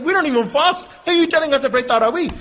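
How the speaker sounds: arrogant boast. Retold or imitated. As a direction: scoff proudly at the question. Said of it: "So proud, so arrogant".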